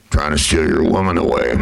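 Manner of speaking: deep muffled cowboy voice; Sam Elliott impression. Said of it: "Sam Elliott voice in movies".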